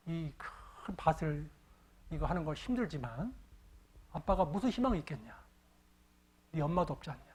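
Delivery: a quiet, tearful father talking low to his son. Retold or imitated. Father in tears speaks to his son, softly pleading.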